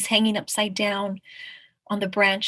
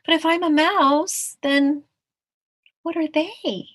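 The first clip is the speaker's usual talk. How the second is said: higher-pitched, mildly worried question; a little worried; 8 semitones higher, 4 dB louder, slower than usual. Acted. Tiger asking the mouse about the others, mildly worried. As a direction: lift the voice in puzzled doubt. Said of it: "looks a little worried".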